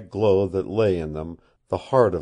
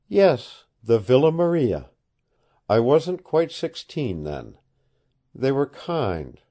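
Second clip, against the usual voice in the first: lightly voiced young woman, pitch raised; a hint of an earnest girl pleading; 5 semitones higher, 3 dB louder than usual. Woman recalls her school.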